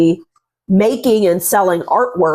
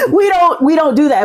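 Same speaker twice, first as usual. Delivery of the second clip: laughing; 10 semitones higher, faster than usual. Real, not acted. Laughing at own point.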